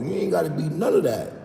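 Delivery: normal talking voice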